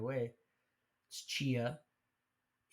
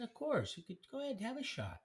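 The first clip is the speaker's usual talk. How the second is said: much higher, quick and bright offer; mock eager; 11 semitones higher than usual. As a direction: play it overly agreeable. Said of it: "I said".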